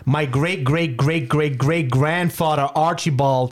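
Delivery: lightly voiced, drawn-out ancestral boast; a hint of a pompous American, dragging out each generation; mock American boaster. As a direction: stretch it out in gentle parody.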